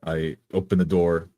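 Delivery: usual speaking voice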